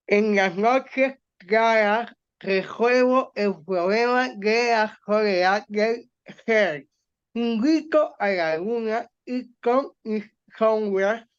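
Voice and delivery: slow, muffled reading with a pencil clamped in the teeth; leyendo con un lápiz en la boca. Shown as a demonstration. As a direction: read it with a pencil between your teeth. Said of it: "voy a leer el número uno". (leyendo despacio con un lápiz entre los dientes)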